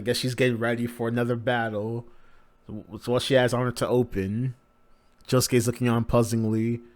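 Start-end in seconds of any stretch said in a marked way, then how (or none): none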